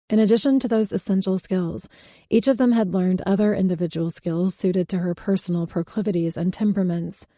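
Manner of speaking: ordinary delivery, nothing marked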